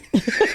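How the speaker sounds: sudden high burst of laughter. Real, not acted. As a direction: let a real laugh burst out. Husband laughing at wife's retort.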